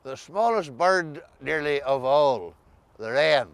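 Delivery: normal talking voice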